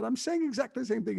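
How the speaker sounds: high, strained protest at exactly the same thing; mock exasperation. Actor protesting to laughing Jackie Chan, high mock exasperation. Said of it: "I said".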